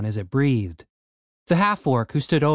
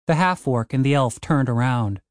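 ordinary delivery, nothing marked

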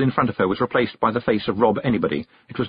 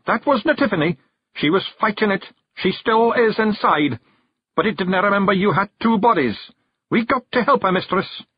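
high Scottish Feegle pleading his case; Rob Anybody, earnest; 10 semitones higher than usual. Acted. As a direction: explain urgently as a tiny Scot. Narrator plays Rob Anybody.

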